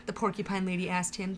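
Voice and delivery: ordinary delivery, nothing marked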